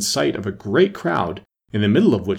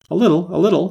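high, jumpy repeated reply; flustered, high-pitched hedging; rather nervous; 8 semitones higher, 4 dB louder, faster than usual. A narrator's reading. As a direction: answer nervously, pitch jumping up. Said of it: "the king replied rather nervously".

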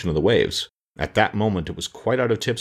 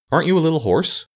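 higher-pitched polite question; 9 semitones higher than usual. A narrator's reading. Pumpkin head asks the sawhorse, politely. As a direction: ask it lightly and politely. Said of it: "asked the pumpkin head politely".

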